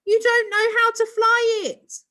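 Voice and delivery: high, loud frantic shout. A narrator's reading. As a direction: shout through the door. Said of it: "Ricky shouted".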